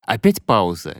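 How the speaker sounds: plain reading voice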